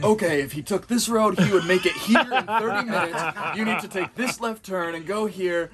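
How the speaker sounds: buddy on the phone, guiding the lost driver, animated; excited directions. Retold or imitated. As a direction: bark out the route like mission control. Dave giving directions over phone.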